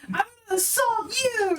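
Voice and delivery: high, fast hammy declaration; dramatic soliloquy. Pretend. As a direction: ham it up like a stage villain. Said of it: "I soliloquy".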